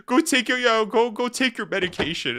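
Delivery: high mock scolding; imitating upset commenter. Streamer quoting the old man's insult, mock upset. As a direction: mildly mimic an indignant old man. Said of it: "so upset".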